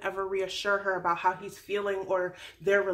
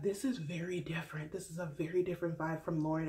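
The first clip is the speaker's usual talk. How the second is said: softer, hushed, taken-aback voice; awkward family; 5 semitones lower, 8 dB quieter than usual. Retold or imitated. The family reacting to Becca, hushed and taken aback. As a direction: mutter it like a stunned relative. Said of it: "that shock face and that awkward face".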